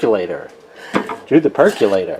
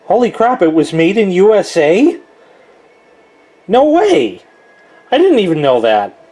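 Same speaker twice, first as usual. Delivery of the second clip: high-pitched astonished discovery; amazed and incredulous; real surprise; 5 semitones higher than usual. Real, not acted. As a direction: react in disbelief, voice jumping up. Surprised by label on his percolator.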